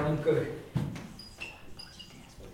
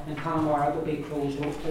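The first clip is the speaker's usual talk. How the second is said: ordinary delivery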